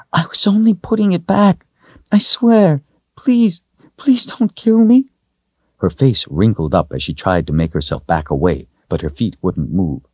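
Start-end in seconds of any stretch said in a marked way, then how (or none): 0.1-5.1 put-on very high pleading little girl; frightened whining. A narrator's reading. Girl pleading with the wizard not to kill her, frightened whine. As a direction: beg for your life in a high whine. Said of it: "she whined, fully expecting to be struck dead".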